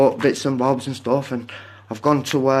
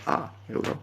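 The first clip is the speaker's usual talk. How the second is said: whispered aside; 10 dB quieter than usual. Real, not acted. Muttering while ending the stream.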